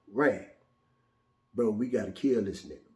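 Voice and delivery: low, soft urgent aside; hushed urging. Retold or imitated. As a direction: keep it down so dad won't hear. Whispering plan to brother.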